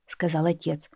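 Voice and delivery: ordinary delivery, nothing marked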